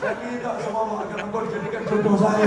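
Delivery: mock widow begging for marriage; pleading widow. Widow Zubaidah pleading with Sulaiman.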